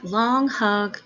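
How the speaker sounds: ordinary delivery, nothing marked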